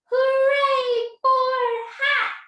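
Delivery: high loud slow hooray; loud cheer. Acted. The animals cheering for hats together, loud and high. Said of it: "they said".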